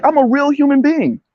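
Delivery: high-pitched goofy voice, joking with his lady; high silly voice. Retold or imitated. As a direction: squeak it out like a goofball. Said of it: "I was like".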